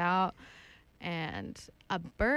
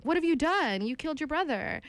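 hammed-up shocked mother, higher and louder; mock accusing gasp; 8 semitones higher, 5 dB louder than usual. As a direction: play the mother feigning horror. Mother accuses daughter in retold tale.

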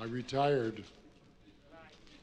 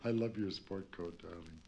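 much lower and softer, a purring flirt; sultry come-on; 8 semitones lower, 8 dB quieter than usual. Acted. Flirting with an audience member's coat, low soft purr. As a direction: purr it at him like a vamp.

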